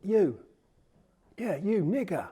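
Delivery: loud, high-pitched taunting call; imitated shout. A kid shouting over to a passer-by across the street, imitated shout. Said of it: "he shouted over to me".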